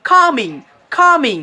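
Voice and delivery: কামিং as "KO-ming", b silent; smooth m in কামিং; correct pronunciation. Shown as a demonstration. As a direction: model কামিং with falling tune and no b. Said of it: "উচ্চারণ করবেন".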